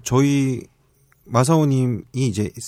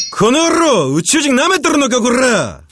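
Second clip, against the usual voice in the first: high slow warbling alien; wobbly alien snarl; 14 semitones higher, 5 dB louder, slower than usual. Acted. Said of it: "그 외계인 말투로". (높고 느리게 떠는 외계인 말투)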